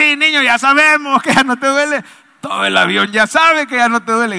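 mock weary impatience, pushed high and loud; fed-up passenger. Preacher as passengers answering the noisy boy, exasperated.

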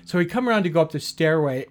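ordinary delivery

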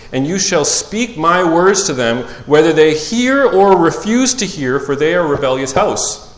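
rising to a loud preaching pitch; scripture reading. Acted. Pastor reading Ezekiel to church, building to preaching. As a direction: proclaim the prophet's charge, building in force.

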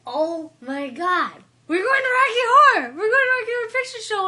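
shrieking very high and loud with joy, almost crying; overjoyed, near-tearful shriek of delight; thrilled squeal. Retold or imitated. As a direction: scream it like a dream just came true. Surprise trip to Rocky Horror.

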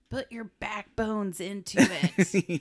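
loud, slow skeleton voice; skeleton soldier. Retold or imitated. Skeletons trying to ram the door, loud drawn-out rallying call. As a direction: imitate a skeleton rallying the ramming crew. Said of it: "and they're like".

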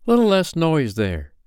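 loud, high, slow call. A narrator's reading. Peter quieting the boys for Wendy, calling out. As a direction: call across the room for order. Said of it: "Peter called out".

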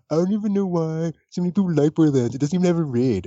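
high, put-on whiny complaint; mocking complainer. Quoting a Borderlands critic from Twitter, mocking complaint. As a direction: mimic an indignant online griper.

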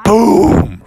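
loud imitated heavy thud; booming footstep. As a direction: boom it out like a giant step.

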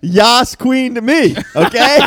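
sassy. Pretend. Sassy catchphrase quote.

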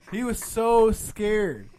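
usual speaking voice

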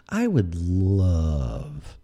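LOVE stretched out long and slow; drawn-out emphasis. Real, not acted. Comic wishing to sit in on marketing meetings, drawn-out LOVE. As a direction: draw out the word love, really savoring it.

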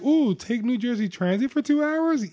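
high-pitched sarcastic enthusiasm; mock eager. Teasing friend about a two-hour train ride, mock eager. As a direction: gush with exaggerated, put-on delight.

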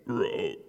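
toad croak. A narrator's reading. Animals scramble into truck.

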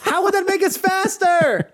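yelling over the wind. Pretend. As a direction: shout it over rushing wind. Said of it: "Why are you two yelling?".

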